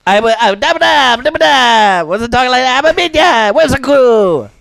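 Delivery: high, loud cartoon minion squeak. Showing what a minion sounds like, high and loud. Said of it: "I was doing minion voice".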